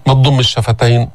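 ordinary talk, nothing marked

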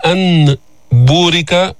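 "أن بورك" with a clear, separate n before b; clear izhar. Shown as a demonstration. Teacher showing unwanted izhar, "أن بورك" with a clear n. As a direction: sound the noon fully: an, būrika. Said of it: "هل أظهرناها فقلنا".

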